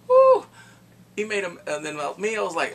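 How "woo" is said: very high, loud cheer; loud woo. Real, not acted. Excited outburst.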